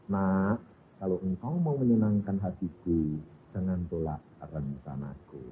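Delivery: low, soft and slow; God speaking tenderly. Retold or imitated. God comforts the crying girl, low, soft and slow. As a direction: gently coax a child. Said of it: "Tuhan berbicara kepada perempuan".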